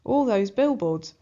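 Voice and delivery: plain reading voice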